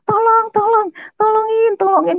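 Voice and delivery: very high, loud mock scream for help; retold cry for help. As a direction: imitate someone screaming for help. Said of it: "ada orang teriak-teriak". (menirukan orang teriak minta tolong)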